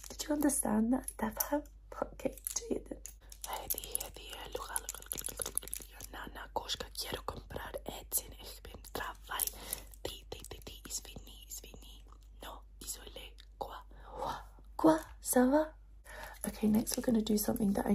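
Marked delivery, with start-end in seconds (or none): 3.5-14.3 soft, jumbled multilingual whisper; whisper, hopping between languages. Shown as a demonstration. Doctor muddling languages in patient's ear, whispered. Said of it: "whisper some languages in your ear".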